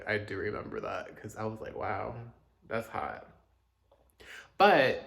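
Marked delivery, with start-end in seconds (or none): none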